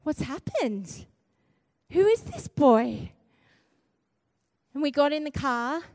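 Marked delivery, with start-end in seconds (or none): 0.0-3.1 slow, astonished questioning; stunned mum wondering who this is; amazed disbelief. Retold or imitated. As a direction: draw it out, baffled by the change. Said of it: "And I'm just like".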